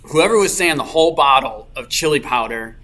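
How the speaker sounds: normal talking voice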